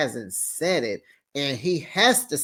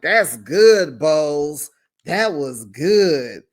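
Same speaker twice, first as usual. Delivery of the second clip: impressed; 5 dB louder, slower than usual. Retold or imitated. Host cheering Bose's line.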